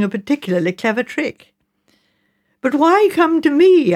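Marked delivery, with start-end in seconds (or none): 2.6-3.9 sharp, higher, louder demand; demanding question. A narrator's reading. Narrator demands of Mrs Baker why him, sharp. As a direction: push back, baffled and insistent. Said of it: "I demanded".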